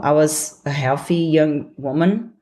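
ordinary delivery

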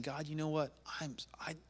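hushed, halting plea; humbled prayer. Acted. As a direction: falter into a near whisper before God. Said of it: "You've gotta humble yourself".

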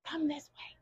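whispered coaxing. Real, not acted. Luring the cow closer, whispered coaxing. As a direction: breathe it out to lure the cow.

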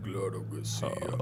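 very low put-on voice; gravelly mock radio voice; deep voice. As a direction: drop the voice as low as it goes. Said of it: "¿Podría ser una voz grave o no?".

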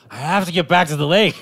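high, loud laughing plea; pleading while laughing. Pretend. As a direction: beg through laughter. Pretend mermaid pleading to cop.

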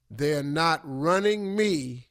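slow, deliberate declaration; drawn-out, measured insistence; emphatic restatement. Real, not acted. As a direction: spell it out slowly and firmly. Emphatic restatement of earlier line.

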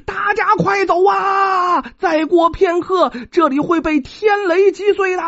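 high, slow, drawn-out warning shout; loud shout. Acted. Wu Tong warns the crowd of the coming thunder, shouting. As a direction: yell it across the crowd to save them. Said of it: "转身大喊".

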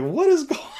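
high-pitched mock squeal; imitating helpless laughter. Youth leader retelling his laughing fit, high squealed question. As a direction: jump way up high, as if overcome by laughter. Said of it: "And I laughed again".

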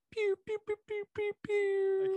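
very high laser pews; laser pew sounds. Pretend. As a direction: zap like a toy blaster.

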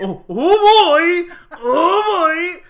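high, loud mock "oh boy". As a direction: blow it up into a ridiculous squeal. Mocking his reply in exaggeration.

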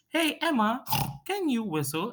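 cartoon girl voice. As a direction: call up a friend in a high kid voice. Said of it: "she goes".

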